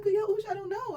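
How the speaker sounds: helpless plea. Retold or imitated. Pleading with Yahusha in vision.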